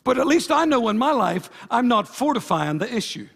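loud, rising preacher calls; full-force preaching. Acted. Pastor urging the church to pray for others, preaching loud. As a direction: hammer each point home from the pulpit.

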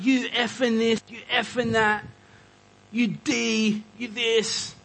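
high-pitched mock rant at a stranger; retold road-rage outburst, lightly hammed; imitating a raging guy. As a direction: rant like an angry driver, half-played. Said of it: "This guy comes out and he is raging".

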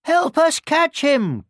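high, slow call for help; storybook chasers calling out; cried out. A narrator's reading. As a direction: cry for help in a high, drawn-out voice. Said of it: "cried the old woman and the farmer".

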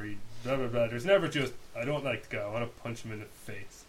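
usual speaking voice

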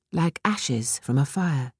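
ordinary delivery, nothing marked

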